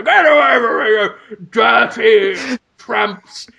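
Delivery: hammed-up posh officer, slowing on the insult; General Grievous. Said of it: "who is a posh man from the war".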